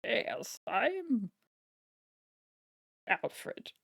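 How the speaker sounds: mock-posh British man introducing himself; male posh voice. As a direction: play a pompous upper-class Englishman, drawn out. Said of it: "like, a male posh".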